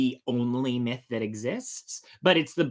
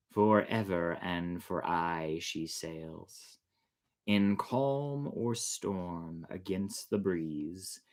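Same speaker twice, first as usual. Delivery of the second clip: slow, softer ballad recital, lower; poem recitation; 5 semitones lower, 4 dB quieter, much slower than usual. A narrator's reading. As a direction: let each verse fade like a sea ballad. Recites closing ballad verses.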